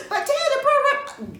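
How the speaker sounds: put-on high pleading girl's voice; a daughter begging her daddy, high and small; little girl voice. Daughter pleading with angry father, high girlish voice.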